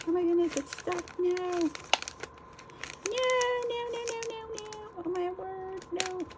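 very high-pitched, drawn-in dismay; dismayed squeal. Real, not acted. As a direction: squeal a string of panicked little protests. Dismay as the piece gets stuck.

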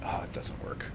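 voiceless, dismissive whisper; whispered doubt. Retold or imitated. A sceptic about breathing, whispering a dismissal.

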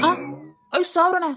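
stunned at the sum; husband shocked by five thousand. Acted. Husband shocked at money demand.

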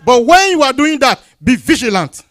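sudden loud, high preacher's warning; forceful preaching. Acted. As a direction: burst out in a warning to the flock.

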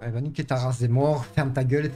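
ordinary talk

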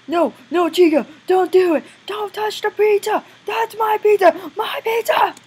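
high, loud protest rising to a wail; hammed-up pleading. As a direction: beg desperately in a squealing mock panic. Acts out protecting pizza from Chica.

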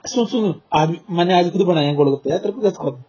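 ordinary delivery

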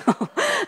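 short laugh. Real, not acted. Laughs at husbands' excuse.

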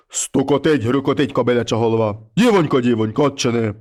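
low, heavy voice of a wolf head at the door; low monster head voice. Acted. Wolf head knocks and calls the girl, low and heavy.

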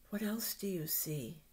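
whisper. Acted. Teacher whispering question to children.

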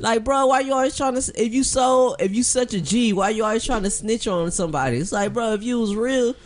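worked-up mock scolding; heated rant. Real, not acted. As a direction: call him out. Mocking rant at Draco.

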